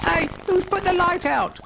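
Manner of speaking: very high, alarmed squeak. A narrator's reading. Brian finds himself suddenly in the dark, high squeak. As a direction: squeak it out in a tiny voice. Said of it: "squeaked Brian".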